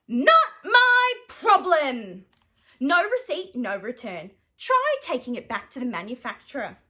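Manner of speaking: snooty no-returns voice; curt refusal. Acted. Shop lady refuses.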